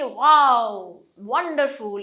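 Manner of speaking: loud, drawn-out wow of amazement; exclaiming wonder. Shown as a demonstration. Teacher showing learners an exclamation, amazed. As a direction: exclaim it slowly and admiringly. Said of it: "এরকম করে বলতে চাই".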